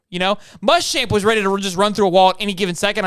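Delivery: usual speaking voice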